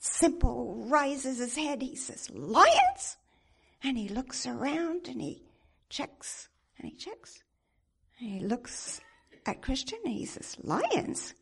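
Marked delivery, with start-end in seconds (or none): 2.3-3.2 loud, high startled "Lions?"; half-asleep alarm, louder; startled question. Acted. Simple wakes, hears about lions. 10.6-11.3 lazy disbelieving echo; doubtful question. Acted. Simple doubts the lions.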